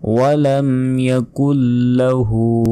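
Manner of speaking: ইয়াকুল লাহু slow with the lla held; held lahu. Shown as a demonstration. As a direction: stretch লাহু one second. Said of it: "ইয়াকুল লাহু হতে এক সেকেন্ড টেনে পড়বেন".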